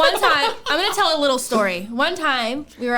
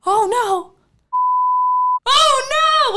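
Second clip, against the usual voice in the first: rising alarm; 6 dB louder, much slower than usual. Real, not acted. Rising alarm at address leak.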